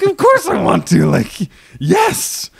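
eager yes. Retold or imitated. Accepting the championship spot, excited. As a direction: burst out eagerly, pitch jumping up. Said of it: "I'm like".

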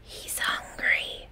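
slow hushed whisper. A narrator's reading. Lily hiding in the baseboard, slow soft whisper. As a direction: breathe it, no voice, hiding. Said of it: "whispered Lily".

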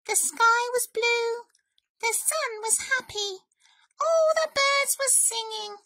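high, sing-song mouse voice; high little storybook reader; mouse reading aloud. A narrator's reading. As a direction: read a sunny book as a tiny mouse. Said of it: "Mouse read".

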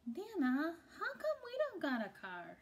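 put-on a little boy begging; child pleading.

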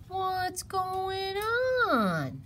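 drawn-out, high snake question; stretched-out creature voice. A narrator's reading. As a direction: slide through the words sinuously. Snake slides in and asks.

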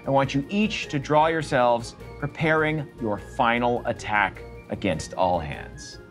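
ordinary talk

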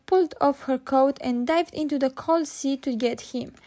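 ordinary delivery, nothing marked

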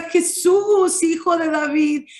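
high-pitched imitated call, easing down; insistent beggar's cry, acted with restraint; retold insistent shout. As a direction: plead over those hushing you, half-shouted. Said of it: "dice que gritaba con más fuerza".

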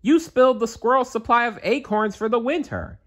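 high cartoon nephew, puzzled; young nephew voice. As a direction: pitch up high as a puzzled kid. Narrator voicing nephew.

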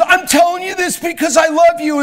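impassioned high yell; intense shouting. Retold or imitated. Son going at five hundred men, intense high shouting. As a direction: shout it fervently at the crowd. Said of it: "he was just going".

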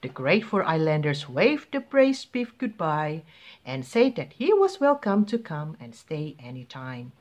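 ordinary delivery

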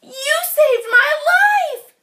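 high, loud, tearful thanks; relieved cry. Acted. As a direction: shout with grateful relief. Frog's tearful relieved shout.

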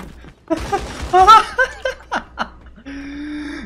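gargalhada longa, alta e aguda. Real, not acted. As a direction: solte uma risada escandalosa. Risada após a torreta destruir. (gargalhada alta e aguda)

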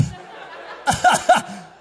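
a breathy, airy laugh, mimicking mom; imitating mom's laugh. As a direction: laugh it off like she did. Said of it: "and she laughed".

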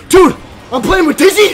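breathless-with-joy shouting, very loud; overexcited, starstruck yelling; real freak-out. Real, not acted. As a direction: blurt it out at full volume. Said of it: "I'm freaking out!".

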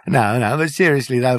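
usual speaking voice

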